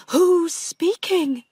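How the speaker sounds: scared of a ghost; frightened. Acted. Pauline startled by ghost.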